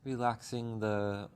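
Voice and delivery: unhurried meditation guide with long pauses; calm, even voice, leaving space between phrases; guided meditation. Acted. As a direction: ease listeners gently through breath and body.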